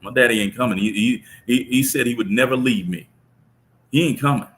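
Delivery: put-on a boy shouting that his father will not go; son yelling. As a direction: cry it out to stop the deal. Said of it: "my son yells out".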